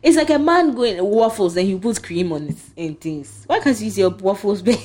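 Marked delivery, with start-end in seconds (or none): none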